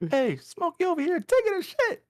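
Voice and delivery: Chris Tucker impression. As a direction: squeal it like the film. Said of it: "when he's like".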